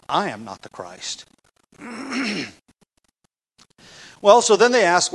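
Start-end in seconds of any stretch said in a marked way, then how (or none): 0.1-1.3 softer, lower reading with "I" leading; weight the pronoun "I", let the rest fall quietly; stress on I. Shown as a demonstration. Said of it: "with the emphasis on the I".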